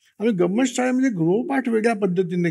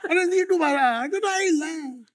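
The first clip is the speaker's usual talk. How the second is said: exasperated laugh; 8 semitones higher than usual. Real, not acted. Scolding parents' negativity.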